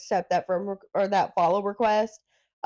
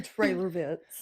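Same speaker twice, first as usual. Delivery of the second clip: laughing; 4 semitones lower, 4 dB quieter, slower than usual. Real, not acted. Laughing at the joke.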